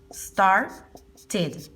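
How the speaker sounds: started split as "star... tid"; started with a separate, clear "tid"; ID ending. Shown as a demonstration. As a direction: pause between the syllables of started. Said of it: "Se pronunciaría así".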